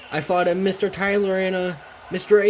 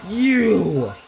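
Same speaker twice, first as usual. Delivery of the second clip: drawn-out, higher accusing shout; single out the culprit with a raised voice; angry call; 6 semitones higher, 4 dB louder than usual. Acted. Thomas resumes screaming at kids.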